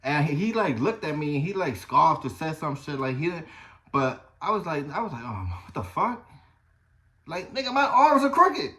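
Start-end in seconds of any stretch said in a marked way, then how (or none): 5.2-8.7 high, loud incredulous rant; exasperated disbelief. Retold or imitated. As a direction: blurt it out in disbelief. Retold exasperated inner reaction.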